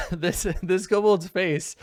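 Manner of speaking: can't help laughing at the picture. Real, not acted. GM laughing while describing the kobold art.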